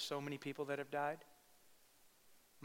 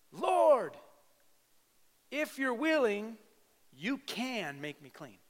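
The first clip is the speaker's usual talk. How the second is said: much higher, loud then slowing plea "Lord"; leper's cry; 8 semitones higher, 6 dB louder, much slower than usual. Retold or imitated. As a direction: cry out to Jesus, then slow down. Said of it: "Here's what he yells out".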